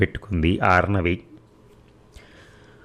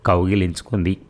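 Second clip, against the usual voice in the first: ordinary delivery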